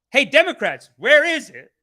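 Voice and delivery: high, loud, impatient demand; loud calling out. Pretend. Host demanding a Trump trial from Democrats, loud call-out. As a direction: holler it like summoning someone who owes you.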